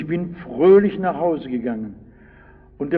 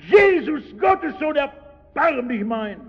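much higher pleading shout, slowing at the end; even louder cry; 8 semitones higher than usual. Retold or imitated. Blind beggar shouting over the crowd to Jesus, high pleading cry. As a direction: keep crying out, refuse to be silenced. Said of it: "Und er".

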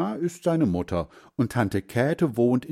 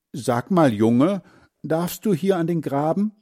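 higher, louder voice of a neighbour woman; woman calling out; 7 semitones higher, 3 dB louder than usual. A narrator's reading. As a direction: call over the fence to the boy, a bit stern. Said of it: "Auf einmal sagt eine Stimme".

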